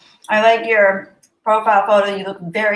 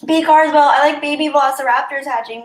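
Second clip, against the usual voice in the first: excited; 6 semitones higher, 6 dB louder than usual. Real, not acted. Excited reading of comment.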